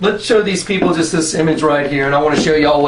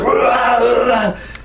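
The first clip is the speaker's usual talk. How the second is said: wordless beast-like roar, pitched up; imitated raging roar; 5 semitones higher than usual. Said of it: "screaming in my face".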